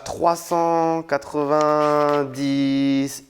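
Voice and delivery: very slow, stretched-out page number; long held vowels, counting slowly upward; drawn out. Real, not acted. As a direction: drag each syllable out while searching for the page. Drags out number while flipping pages.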